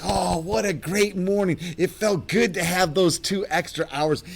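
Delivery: high, cheerful morning voice; husband cheerful after waking up refreshed; refreshed and happy. Retold or imitated. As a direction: beam like a man who slept great. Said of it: "so refreshed and happy".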